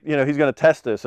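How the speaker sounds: ordinary delivery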